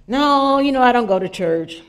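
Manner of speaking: loud defensive no; loud protest. Retold or imitated. Friend loudly protests to the preacher about church.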